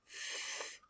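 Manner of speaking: Th as quiet breathy friction; voiceless Th drawn out on its own; isolated th sound. Shown as a demonstration. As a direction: hold Th with tongue at the teeth. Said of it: "notice my tongue".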